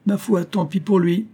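high-pitched, cheerful dismissal; joyful. A narrator's reading. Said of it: "dit Charles Joyeux".

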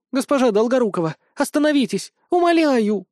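high-pitched desperate begging; pleading. A narrator's reading. Brunette begs her mistress to stop, pleading. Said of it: "взмолилась брюнетка в белом халате".

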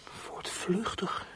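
breathy unvoiced whisper of the word. Runaway boy whispering the word back to himself.

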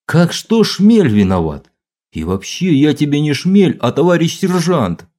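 high, loud fake indignation; mock outrage. A narrator's reading. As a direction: bluster in pretend offence. Sergeant feigning outrage, loud.

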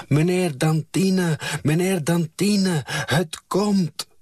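high-pitched calling out, narrated; child's urgent cry with the breath left; breathless shouting. A narrator's reading. Child calls Meneer Dantine at the farm, shouting. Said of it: "zette ze het op een schreeuwen".

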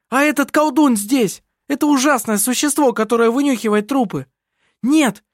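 high, heated, indignant protest; outraged tirade. A narrator's reading. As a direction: rail against how unfair it all is. Heated quarrel, bitter complaint of unfairness.

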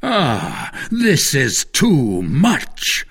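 slow, drawn-out indignant protest; crying out. A narrator's reading. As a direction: exclaim slowly, stretching the words. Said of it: "cried Buckingham".

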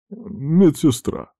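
high, slow, sniffly address; sniffling. A narrator's reading. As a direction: say it slowly through a hurt nose. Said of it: "шмыгнул носом Штраузер". (шмыгая носом, протяжно)

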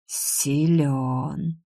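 soft, drawn-out demon hiss; displeased hiss. A narrator's reading. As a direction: hiss it slowly and grudgingly. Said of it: "недовольно прошипел Анташар".

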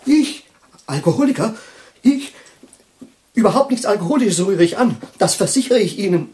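high, loud, offended protest; indignant outburst. Acted. Accused man loudly denying he drinks, high indignant outburst. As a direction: hurl the denial back, outraged. Indignant denial of being an alcoholic.

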